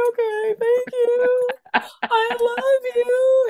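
very high, drawn-out weepy wail; hammed-up crying thanks; mock tearful gratitude. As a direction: squeak it out as if sobbing with love. Retold tearful reply, told to calm down.

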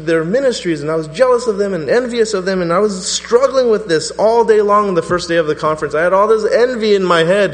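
loud, high preaching confession; fervent sermon confession; preaching at full force. Acted. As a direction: pour out the confession to the congregation.

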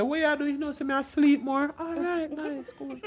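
high soft mock chit-chat; mock small talk. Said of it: "Or, or say".

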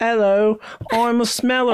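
high-pitched, hammed-up Oliver Twist British accent; terrible British accent. Joking audition as a ghost smeller, in a terrible British accent. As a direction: plead like a badly faked British street orphan. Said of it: "that's my terrible accent".

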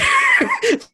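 bursting high hoot of laughter; real loud cackle at the punchline; shrieking laugh, way up high; big laugh. Real, not acted. Laughing at own prank story.

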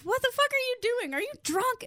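high-pitched retold disbelief; incredulous scolding. Sonia confronts Emmy at the bar, incredulous scolding. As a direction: pitch it up, demanding an answer.

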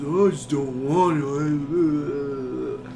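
drawn-out, slow mock grumble; mocking, long-drawn reluctance; imitating reluctant dad. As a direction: drag it out like an unwilling dad. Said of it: "He never wants to do anything anymore".

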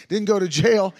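"didn't go to jail" hit loud, leaning on "jail"; stressed jail. Retold or imitated. Preacher repeating the pastor's shocking words, "jail" stressed loud. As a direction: punch "jail" as if it still stings.

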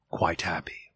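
unvoiced soft whisper. A narrator's reading. Narrator ending Bob's grief, whispered. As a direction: breathe it almost silently.

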